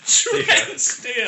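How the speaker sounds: slow, high, savoring the name; suave action hero name. Pretend. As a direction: roll it out like a movie hero. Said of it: "I actually like the name, uh, Trent Steele".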